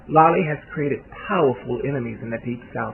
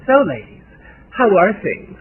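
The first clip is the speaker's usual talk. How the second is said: higher, louder hostess greeting; hostess breaking the silence; 7 semitones higher, 8 dB louder than usual. A narrator's reading. Kelly breaking the silence among her guests, bright hostess voice. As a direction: call out to the guests in a raised, social voice.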